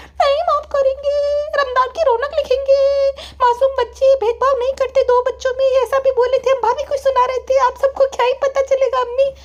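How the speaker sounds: very high, whiny complaint; daughter complaining. Acted. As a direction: complain to mother in a high childish whine. Daughter refuses. (शिकायत करती बेटी की बहुत ऊँची आवाज़)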